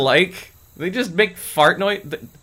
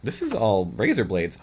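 ordinary delivery

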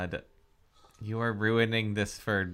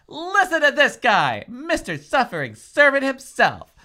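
much higher and louder, mocking; loud, sky-facing mockery; 13 semitones higher, 10 dB louder than usual. Reader shouting her mocking line skyward. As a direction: sneer it up at the sky. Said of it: "I stood and spoke to the sky".

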